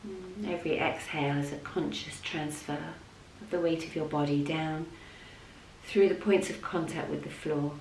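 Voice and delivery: soft, level voice with long pauses; unhurried, hushed yoga nidra guidance; guided meditation. Real, not acted. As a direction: guide them gently down into rest.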